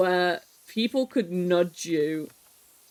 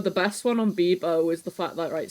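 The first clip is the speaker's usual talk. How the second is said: ordinary delivery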